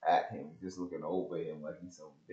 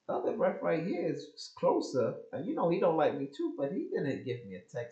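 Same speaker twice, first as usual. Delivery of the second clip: heated protest; 10 semitones higher, 6 dB louder than usual. Retold or imitated. Retold protest to referee.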